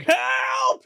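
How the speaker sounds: very high mock shout for help; hammed-up high-pitched yell; Leslie Nielsen mocking cry. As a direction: shriek help as a taunting echo. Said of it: "Leslie Nielsen's like".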